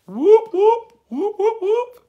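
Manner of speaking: very high, loud mock siren; imitated police siren whoops. Imitates police siren behind her.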